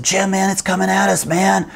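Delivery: high alarmed shout; panicked yell. Retold or imitated. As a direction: shout the warning as it charges. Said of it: "so I yelled".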